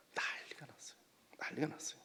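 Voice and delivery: very soft, near-whispered remark; hushed aside. Real, not acted. Preacher tells congregation of the commotion, hushed aside. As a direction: drop right down, almost to a whisper.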